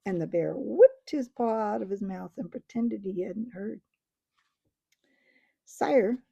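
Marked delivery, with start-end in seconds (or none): 5.6-6.2 higher, louder little mouse call; shrill mouse voice. A narrator's reading. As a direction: pipe up from the ground like a tiny knight. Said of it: "came a shrill voice from near the ground".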